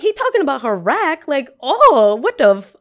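high, loud shocked squeal; gasping at a boy talking about her rack. Retold or imitated. High, loud, shocked.

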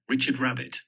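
ordinary delivery

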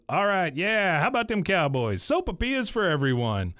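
mock celebratory announcement; Hank. Acting out Hank cheering for everyone.

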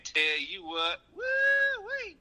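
Southern twang with a high yelping woo-wee; hammed-up Southern holler. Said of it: "It's my pizza delivery voice".

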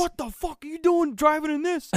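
high, loud imitation of an angry dad; mock outraged scolding; pissed-off dad. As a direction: yell it like a furious father, hammed-up. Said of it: "he was so pissed".

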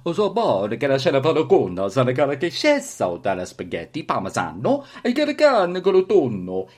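loud, hammy mock Italian gibberish; fake Italian. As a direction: go big with pretend Italian. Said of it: "Now we can speak Italian".

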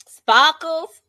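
put-on loud, high Boston-accented jeer; punchy imitation Boston accent. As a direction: call out the nickname in mock Boston. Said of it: "between the Boston accent".

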